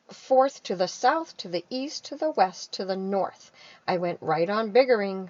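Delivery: big, loud read-aloud of the Once-ler's boasts; loud storytelling. A narrator's reading. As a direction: read it out proudly to the class. Onceler narration read to kids.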